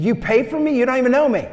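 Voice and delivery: high, loud, disbelieving cry; a retold shock, pitched high; astonished driver. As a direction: play the stunned stranger, half shouting. Astonished driver voiced in story.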